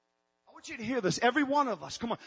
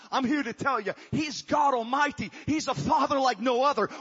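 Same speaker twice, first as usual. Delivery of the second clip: louder, higher preacher's build toward a climax; preaching at full force; 5 semitones higher, 7 dB louder than usual. Acted. Pastor proclaiming God as father to his congregation, full-force preaching. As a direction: proclaim it to the whole church, rising and loud.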